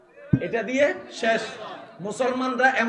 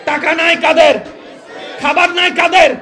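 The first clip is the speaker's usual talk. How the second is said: loud, high volley of rhetorical questions; full-force preaching; 7 semitones higher, 12 dB louder, slower than usual. Real, not acted. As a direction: fire questions at the crowd, building to a shout. Rallies crowd with questions. (উঁচু গলায় একের পর এক প্রশ্ন)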